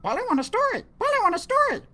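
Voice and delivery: high, squawky parrot voice; squawking parrot. Acted. Parrot squawking back at the pirate captain.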